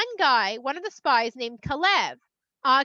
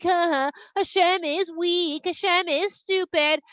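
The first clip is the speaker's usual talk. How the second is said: slow, higher sing-song taunt; mocking; 4 semitones higher, 3 dB louder, slower than usual. Egypt making fun of Hashem, childish mocking. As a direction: jeer it with drawn-out words. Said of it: "They're gonna make fun of you".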